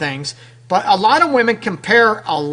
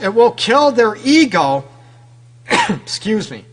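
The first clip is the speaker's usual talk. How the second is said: normal talking voice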